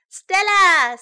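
high, loud name call. A narrator's reading. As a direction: shout for someone in another room. Sam calls Stella again.

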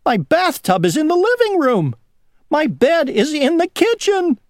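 very high, squealing dismay; panicked cry. A narrator's reading. Worm inside its rolling house, high frantic wail. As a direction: wail each mishap in a high voice. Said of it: "cried the worm".

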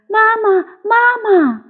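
high, eager little tadpole calls; repeated calling. A narrator's reading. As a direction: call mama over and over in a high child voice. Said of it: "连声喊着".

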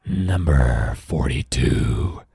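deep, slow monster growl; low, drawn-out monster voice. Acted. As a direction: drag it out low like a big scary creature. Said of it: "says the monster".

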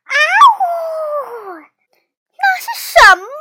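loud howl into an alarmed question; howl, then cry out high; loud shouting. A narrator's reading. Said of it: "大声喊道".

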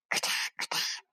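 soft, voiceless crunch imitation; crunching noise. A narrator's reading. Narrator imitating fruit crunching next door, hushed crackle. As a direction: mimic crisp bites in a hushed crackle. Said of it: "果子崩脆的声音".

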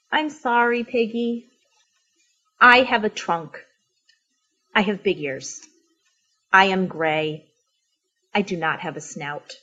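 low, soft, downcast elephant; gloomy, subdued apology; dejected. Acted. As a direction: confess it quietly and sadly. Elephant sadly admits he is different.

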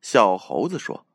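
plain reading voice

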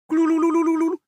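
high trilled rolling noise; rolling sound. Acted. Giant egg tumbling downhill, high rolling trill.

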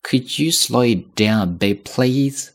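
slow, model read for repeating; 放慢朗读; slowly. Shown as a demonstration. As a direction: read it slowly for students to follow. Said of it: "我慢一些".

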